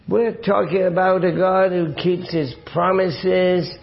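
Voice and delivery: usual speaking voice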